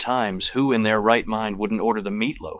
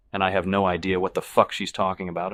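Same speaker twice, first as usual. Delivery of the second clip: usual speaking voice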